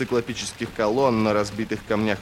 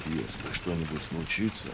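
low, hushed near-whisper; 5 semitones lower, 9 dB quieter than usual. A narrator's reading. Logen privately asking Ferro for help, whispering. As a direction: drop to a secretive whisper. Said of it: "прошептал он".

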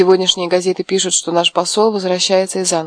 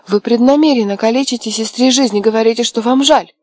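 louder, higher furious accusation; furious reproach; 5 semitones higher, 5 dB louder than usual. A narrator's reading. Миранда обвиняет мужа: громкий яростный упрёк. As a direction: rail at him for ruining her sister's life. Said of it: "взорвалась Миранда".

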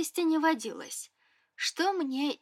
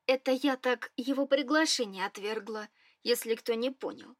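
ordinary delivery

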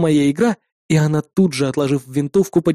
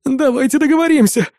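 high, pleading whine; whining plea; 8 semitones higher than usual. A narrator's reading. Engineer begging the captor to negotiate, whining. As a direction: whine and beg in a raised pitch. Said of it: "ныл инженер".

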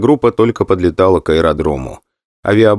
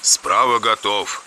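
over intercom; 2 semitones higher, 6 dB quieter, slower than usual. Acted. Copilot reports ready in cockpit.

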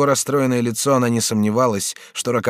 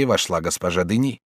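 plain reading voice

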